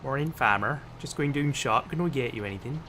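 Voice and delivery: put-on West Country farmer talk; West Country accent. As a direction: play a farming local with a West Country accent. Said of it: "all farmers talk with a West Country accent".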